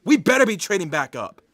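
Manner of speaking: loud, rapid indignant insistence; heated and hurried; angry demand. Real, not acted. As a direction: fire it out as a warning. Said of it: "I'm so mad right now".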